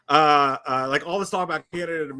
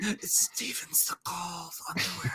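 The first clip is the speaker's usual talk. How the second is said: slow breathy whisper; 9 dB quieter, slower than usual. Retold or imitated. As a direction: whisper it like a secret. Mocking confused movie subtitles.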